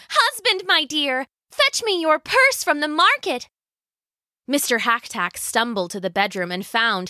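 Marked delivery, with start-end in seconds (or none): 0.1-3.5 high-pitched, excited request; wife calling to her husband, pitched up; eager wife. Acted. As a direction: send him off in a high voice. Wife gives excited order.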